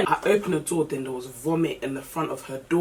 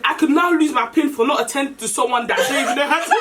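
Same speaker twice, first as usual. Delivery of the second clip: laughing through the words; 8 semitones higher, 4 dB louder, faster than usual. Real, not acted. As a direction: speak while laughing. Laughs while talking.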